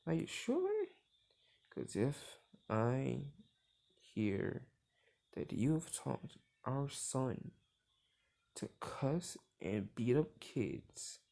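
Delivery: slow, spaced-out mock menace; imitated wife's deliberate ultimatum; slow threat. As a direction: measure out each phrase as a warning. Wife slowly threatens husband.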